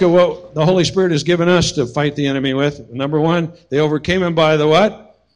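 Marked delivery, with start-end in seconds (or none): 3.7-5.2 call and response. Real, not acted. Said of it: "Let's say that a little louder".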